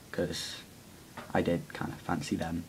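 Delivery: ordinary talk, nothing marked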